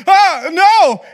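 put-on high, loud, panicked little kid; scared denial. Youngest kid caught by his mom, panicked high denial. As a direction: squeak out a frightened denial.

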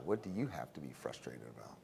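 hushed whisper, no voice at all; soft conspiratorial whisper to the class. Real, not acted. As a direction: lean in and whisper the question. Rhetorical question to the class.

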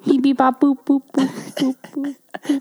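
clipped robot beeping; robot beeps. Pretend. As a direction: beep like a little machine. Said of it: "Mine's consistent".

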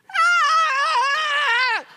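very high, loud gleeful shout; happy toddler squeal. Retold or imitated. Dad imitates daughter's happy squeal in the rain. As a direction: squeal with delight. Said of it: "then she goes, like".

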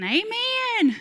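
high, loud, drawn-out rallying yell; yelled amen. Retold or imitated. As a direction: reenact a big call-out to the crew. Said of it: "I was yelling".

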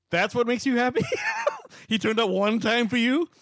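high-pitched incredulous question with a laugh; mock incredulity. Woman hearing he came through once, mock incredulous questioning. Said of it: "like, rightfully going".

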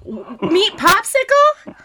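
loud, high incredulous echo; disbelieving laughing shout; surprised. Real, not acted. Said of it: "I was just about to call".

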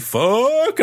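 high-pitched mock-panicked curse; retold panic. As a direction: curse as if bolting from a ghost. Retold fright at ghostly voices.

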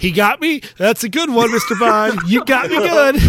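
lightly acted admiring victim; hammed-up good sport. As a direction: playfully concede defeat in character. Acts out victim conceding to Bond.

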